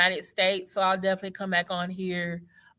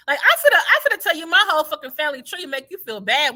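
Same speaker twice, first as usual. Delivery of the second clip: higher, louder and fast, fired up; heated rant; 7 semitones higher, 6 dB louder than usual. Real, not acted. Venting about nosy strangers asking her origins, fired up and fast. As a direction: rattle it off like you're fed up.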